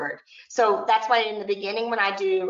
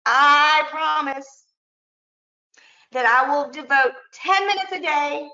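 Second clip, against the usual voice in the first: raised, measured oath-leading voice; pledge to repeat; 3 semitones higher, 4 dB louder than usual. Shown as a demonstration. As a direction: lead a pledge in loud, drawn-out phrases with pauses to echo. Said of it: "repeat after me".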